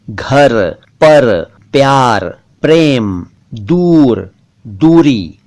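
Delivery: घर, पर, प्यार, प्रेम, दूर, दूरी with drawn-out rolled r; correct र. Shown as a demonstration. Teacher modelling words with र, rolled r in घर, पर, प्यार, प्रेम, दूर, दूरी. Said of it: "जिनमें र है और उसे र ही पढ़ना".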